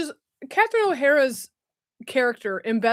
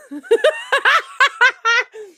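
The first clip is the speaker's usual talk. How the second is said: high, loud burst of laughter; real high cackling laugh; 11 semitones higher, 6 dB louder than usual. Real, not acted. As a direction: crack up helplessly at your own bit. Cracks up at own accent.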